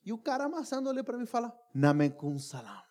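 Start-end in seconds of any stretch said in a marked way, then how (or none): 1.7-2.8 low, drawn-out salaam; gruff baker's reply. Retold or imitated. Baker kneading dough answers the greeting, low and slow. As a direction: answer slowly in a deep acted voice.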